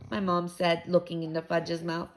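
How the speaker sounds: ordinary delivery, nothing marked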